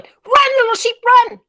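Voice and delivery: high, loud urgent call. Shown as a demonstration. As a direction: cry a frantic warning. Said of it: "But I'm going".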